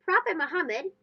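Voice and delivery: ordinary delivery, nothing marked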